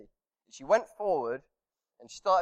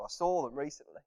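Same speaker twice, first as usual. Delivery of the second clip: ordinary talk, nothing marked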